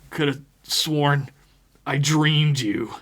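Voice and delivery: mildly shaken, words coming slowly; sputtered out. A narrator's reading. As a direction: sputter it out haltingly, a touch afraid. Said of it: "Johnny managed to sputter out".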